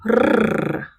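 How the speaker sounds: rolled rrr, tongue buzzing; trilled r. Shown as a demonstration. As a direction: roll the r hard. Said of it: "pronounced with a trill".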